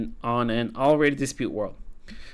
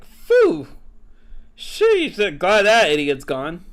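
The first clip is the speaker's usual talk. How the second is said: much higher, loud cartoon cat sigh of relief; relieved cat voice; 4 semitones higher, 7 dB louder, much slower than usual. Cat relieved once the bird is gone, high and loud. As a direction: squeak out a smug cat glad the bird left. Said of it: "He's like".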